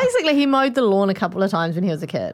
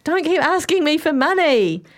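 high, drawn-out imitation of a fed-up customer; hammed-up irritation, pitched up; mock exasperated; 7 semitones higher, 3 dB louder than usual. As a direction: retell it as a pestered complaint, slowly.

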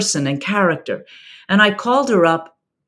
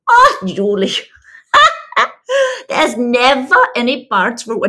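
a self-confessed bad South African accent, breaking into a high laugh; laughing through a loose South African accent, a self-admitted bad imitation; laughing South African friend; 7 semitones higher, 5 dB louder than usual. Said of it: "I'm gonna do a bad imitation".

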